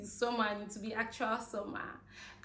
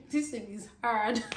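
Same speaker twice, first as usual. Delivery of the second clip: laughing. Real, not acted. Laughing about British accent.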